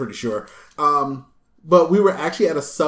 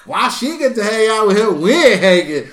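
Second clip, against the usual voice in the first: sassy retort; 6 semitones higher, 8 dB louder than usual. Retold or imitated. Voicing sassy reply to date.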